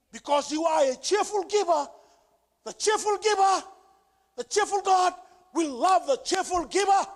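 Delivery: loud, high, rising preacher's cry; fervent sermon climax; preaching at full force. Acted. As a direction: lift the church with it. Preaching builds up.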